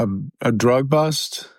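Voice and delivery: usual speaking voice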